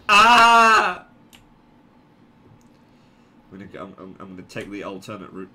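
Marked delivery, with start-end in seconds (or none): 0.1-1.0 loud, high "ah" scream; long alarmed cry. Real, not acted. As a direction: yell out in alarm. Scream as things go wrong.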